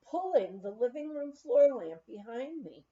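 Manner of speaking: ordinary delivery, nothing marked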